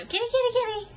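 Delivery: high, sing-song pet call; cat-calling voice. Retold or imitated. Woman re-enacting how she called her nameless cat, high calling.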